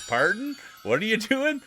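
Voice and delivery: high, rising puzzled questions; high-pitched mock confusion; acted bewilderment. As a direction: replay being baffled by friends. Retelling his puzzled reaction.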